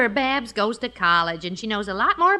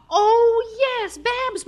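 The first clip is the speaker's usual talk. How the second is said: sudden remembering; 11 semitones higher, 3 dB louder, much slower than usual. Acted.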